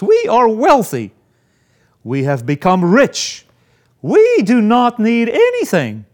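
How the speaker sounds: loud, proud self-satisfied claim; smug boast. Retold or imitated. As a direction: boast like the self-sure church. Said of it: "This is their judgment of themselves".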